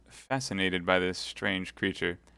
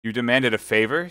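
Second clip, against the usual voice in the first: loud, higher questioning challenge; suspicious; 4 semitones higher, 8 dB louder than usual. Acted. Baron questioning the young officer, suspicious. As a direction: query it with sharp doubt. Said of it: "said the Baron suspiciously".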